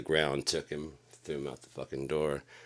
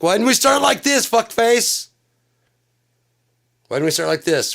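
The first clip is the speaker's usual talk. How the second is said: much higher, much louder yell; furious shout; 15 dB louder than usual. Retold or imitated. Yelling at the bully who tried to fight him, furious shout. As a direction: blast it at him like you've had enough.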